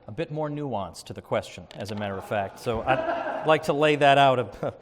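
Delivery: ordinary talk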